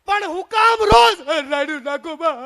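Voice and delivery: high, loud, drawn-out yell; exasperated husband shouting, stretched high. Acted. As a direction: yell over someone's wailing. Uncle yells at wailing aunt.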